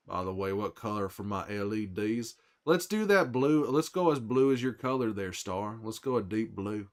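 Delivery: normal talking voice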